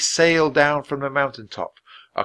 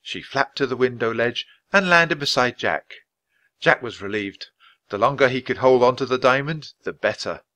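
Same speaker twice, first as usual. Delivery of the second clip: plain reading voice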